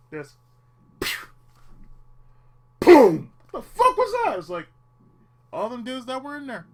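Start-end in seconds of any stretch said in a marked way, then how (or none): 2.8-3.3 loud mock boom; loud sudden explosion sound; explosion noise. Rocket launcher blast. 3.5-4.4 startled. Pretend. Startled reaction to blast.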